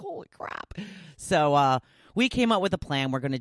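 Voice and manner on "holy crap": hushed, voiceless exclamation of disbelief; whispered shock. Acted. Reacting to a steep parking price, shocked whisper.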